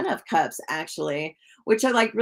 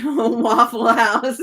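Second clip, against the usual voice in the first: slow, louder laughing words; 4 semitones higher, 6 dB louder, much slower than usual. Real, not acted. Reader cracking up while saying where the client meets her ex, laughing. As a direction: laugh it out at the joke.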